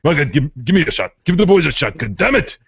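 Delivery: high, loud, fast cursing rant; mock angry fan. Friend plays the cranky fan yelling at Marcel.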